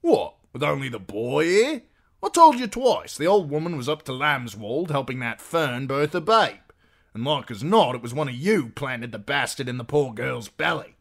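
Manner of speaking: high, loud, exasperated protest; aggrieved husband, indignant. A narrator's reading. Husband accusing guests over the girl, loud indignant voice.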